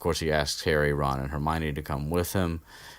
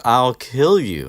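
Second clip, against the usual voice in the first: a lightly voiced louder, slower threat; milder Filch threat; 7 semitones higher, 9 dB louder, slower than usual. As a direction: threaten him, toned down. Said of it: "Filch goes with".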